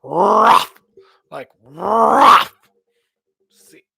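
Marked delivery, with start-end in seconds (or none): 0.0-0.7 loud barking growl; dog growl demo. Shown as a demonstration. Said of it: "learn how to growl like". 1.6-2.5 higher, louder growled bark; dog growl demo again. Shown as a demonstration. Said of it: "learn how to growl like".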